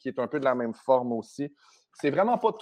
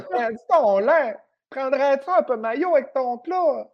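high, loud, hammed-up Saguenay wail; mock being on the verge of tears, in Saguenay accent; near-tears Saguenay whine; 9 semitones higher, 4 dB louder than usual. Offering milk and mayo, near-tears Saguenay whine. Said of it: "Tout le temps sur le bord des larmes".

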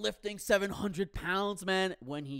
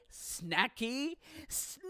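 "snacky snack" high, with "snack" hugely drawn out; appalled self-mockery; 7 semitones higher, much slower than usual. Mocking his own baby talk.

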